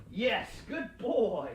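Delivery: slow, sing-song praise for the dog; drawn-out praise. Real, not acted. Trainer praising the dog, slow and upbeat. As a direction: stretch it out, rewarding the pup.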